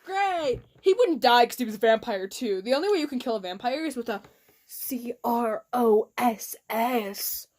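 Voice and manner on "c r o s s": spelled out. Shown as a demonstration. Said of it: "There's no way I'm writing that out".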